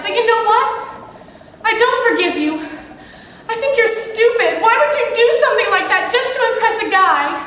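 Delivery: building anger. Acted. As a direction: let the resentment boil over until you're shouting at her. Confronting a friend in anger.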